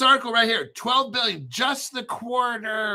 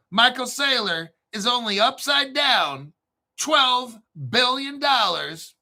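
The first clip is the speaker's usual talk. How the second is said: ordinary delivery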